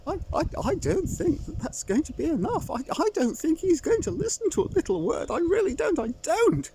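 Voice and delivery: high, fretful hen voice, near tears; a fussing chicken in a flap; high worried chicken; flustered hen. Acted. Lucy frets, stammering.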